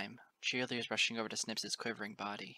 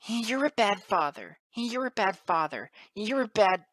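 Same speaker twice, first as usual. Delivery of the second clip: high, loud, repeated wail; howling; 10 semitones higher, 11 dB louder than usual. A narrator's reading. Colt accusing his father, high tearful howling. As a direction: howl it over and over. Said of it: "started howling over and over and over".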